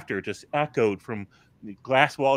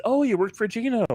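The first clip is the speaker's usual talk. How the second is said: mock surprise; 12 semitones higher than usual. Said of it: "And he says".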